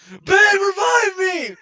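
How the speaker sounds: high, drawn-out gamer scream; mock panicked yell. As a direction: yell it like you're downed in a game. Mimes gaming loudly in library.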